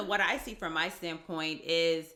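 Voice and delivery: ordinary talk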